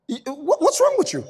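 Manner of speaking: squeaky, high indignant shout; shocked high retort. Retold or imitated. Wife shrieking back at Job in disbelief.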